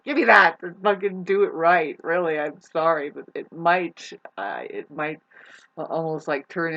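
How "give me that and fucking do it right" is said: irritated outburst. Acted. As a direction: act out losing patience, loud on the first words. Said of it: "get all irritated and say".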